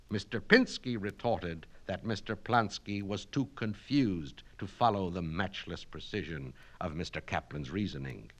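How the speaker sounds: ordinary delivery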